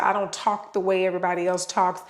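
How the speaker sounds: normal talking voice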